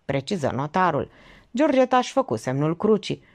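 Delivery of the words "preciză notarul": ordinary delivery, nothing marked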